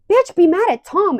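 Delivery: high, loud sassy clapback; mock angry retort. Vlogger acting out the quarrel outside, heated retort. Acts quarrel over Tom.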